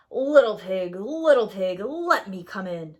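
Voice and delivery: loud, growled call; growling wolf. A narrator's reading. Wolf growls at the third pig's door. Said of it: "The wolf growled at the door".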